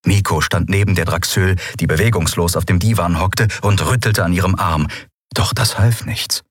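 ordinary delivery, nothing marked

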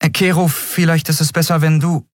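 high, tentative character voice; hesitant objection. A narrator's reading. Endriel tries to back out.

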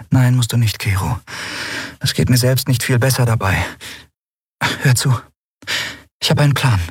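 high-pitched heroine, then purposeful; Endriel, sympathetic. Acted. Endriel commiserates with the Skrier and offers a plan, sympathetic.

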